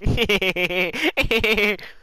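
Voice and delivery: high mock snickering laugh; imitated villain cackle. Villain trolling his foe on the stairs, mock cackle. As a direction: cackle in bursts like a smug villain. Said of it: "He's like".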